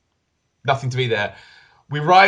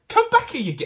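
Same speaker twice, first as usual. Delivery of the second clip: high-pitched, frustrated mock call; exasperated calling after; 14 semitones higher than usual. Player calling at an enemy who ran past, exasperated. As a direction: call after someone slipping past you.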